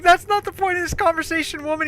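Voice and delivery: very high, loud imitation of a fed-up friend; mock exasperated scolding. Friend dragging her back to the point, mock scolding.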